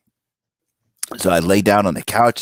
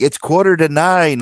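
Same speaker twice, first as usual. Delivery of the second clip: imitating his wife, pitched higher; retold time warning, voice raised in pitch; wife's urgent announcement; 8 semitones higher, 3 dB louder than usual. Wife tells him it's quarter to nine, mock urgent.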